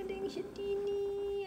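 ordinary delivery

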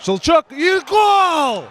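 slow loud drawn-out goal call; excited hockey goal call. Real, not acted. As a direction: stretch out the goal cry. Goal scored, praise.

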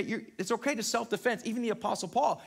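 usual speaking voice

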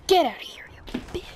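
unvoiced hissed whisper; whispered insult. Real, not acted. Gamer whispering insults at the enemy, unvoiced. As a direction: hiss it under your breath.

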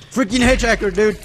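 loud, annoyed outburst; frustrated yell. Real, not acted. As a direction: shout it at the enemy. Attacked by the Hitchhiker.

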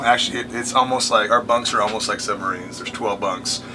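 ordinary delivery

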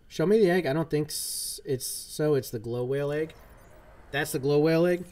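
ordinary delivery

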